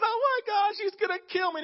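high, frantic Elijah; mock panic. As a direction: squeal it like a man losing his nerve.

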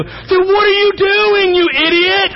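high, loud, slow angry shout; furious high-pitched yell; road rage yell. Retold or imitated. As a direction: yell at the driver through the window. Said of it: "I roll down the window".

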